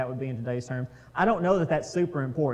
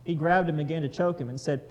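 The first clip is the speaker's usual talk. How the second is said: louder, steady Bible reading voice; scripture reading; 2 semitones higher, 4 dB louder than usual. Acted. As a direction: read the passage out to the group. Reads the parable aloud.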